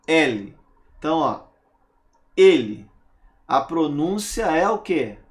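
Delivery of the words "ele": "ele" said with a closed first e, like "êle"; closed e pronoun. Shown as a demonstration. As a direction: stress a closed ê in "ele", loud. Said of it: "Pronúncia fechada".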